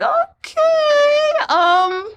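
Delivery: very high, drawn-out mock hesitation; put-on high voice, wheels turning; imitating hesitant woman. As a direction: squeak out a dubious, stretched reaction. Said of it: "Millie is a little bit like".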